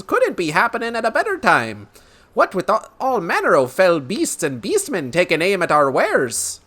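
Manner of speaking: high, hammed-up Irish-style brogue; Lucky Charms leprechaun brogue. Merchant grumbling about raided wares, high leprechaun brogue. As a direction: rant like a leprechaun in a cartoon brogue. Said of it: "Sounds like the Lucky Charms guy".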